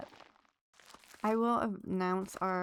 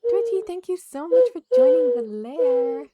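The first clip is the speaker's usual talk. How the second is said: much higher and louder, bursting with excitement; overjoyed thanks; 15 dB louder than usual. Real, not acted. Streamer thanks a viewer for joining, overjoyed shout. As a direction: thank them at the top of your voice. Thanks follower, milestone reached.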